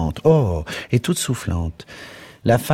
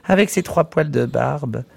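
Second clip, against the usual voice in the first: mewing mockery; 8 semitones higher than usual. A narrator's reading. Miss Kennedy teasing her friend about Bloom, mewing mockery. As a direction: mew it like a cat, mocking him. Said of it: "miaulait mademoiselle Kennedy".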